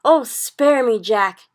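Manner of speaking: loud, high, terrified begging; panicked giant crying out; pleading yell. A narrator's reading. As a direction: yell for mercy. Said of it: "he yelled".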